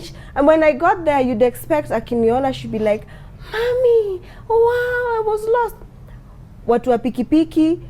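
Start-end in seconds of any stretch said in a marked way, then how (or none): 3.5-5.8 put-on high, bright child's voice; a mother mimicking her cheerful little girl; imitating her daughter. Mother voices her lost daughter, high childlike imitation.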